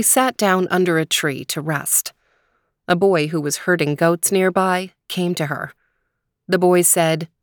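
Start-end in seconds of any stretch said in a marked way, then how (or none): none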